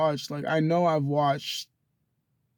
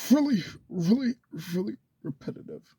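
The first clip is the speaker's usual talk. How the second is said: each really leaned on, first one higher; stressed really; 5 semitones higher than usual. Real, not acted. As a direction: hammer the word really with annoyance. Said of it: "starting to get really repetitive".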